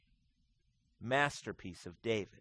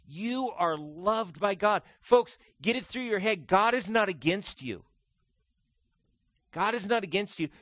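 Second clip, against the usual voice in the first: rising, louder preacher's cry; preaching at full force; 10 semitones higher, 9 dB louder than usual. Real, not acted.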